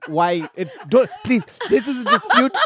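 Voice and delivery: pleading, polite mock Nigerian accent; smooth over a dispute in a comic Nigerian accent; Nigerian prince accent. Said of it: "Do a, do the Nigerian".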